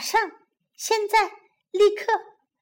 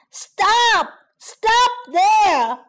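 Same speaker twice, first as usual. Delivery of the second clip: high, loud yell; forceful shout; 6 semitones higher, 5 dB louder, slower than usual. Acted. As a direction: shout hard to halt a runaway. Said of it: "使劲地喊". (使劲地高声喊叫)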